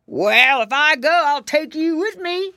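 growly bear. A narrator's reading. Bear threatening to take the piggy boys, growling.

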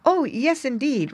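high, courteous reply; polite. Acted. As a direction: answer politely and eagerly. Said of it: "replied Sammy politely".

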